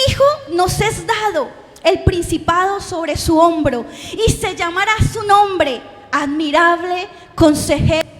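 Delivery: slow, loud proclamation; scripture reading. Acted. Preacher proclaims Isaiah's verse to the congregation, loud and slow.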